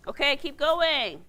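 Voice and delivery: loud, high, drawn-out call. Real, not acted. Teacher calling on the class to keep going, loudly. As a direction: call to the whole room to carry on.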